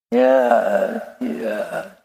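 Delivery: imitated whimper, dying away; drawn-out mock sniffly agreement, fading to a breath; child's tearful yeah. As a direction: answer like a toddler calming from tears. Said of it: "She stopped crying".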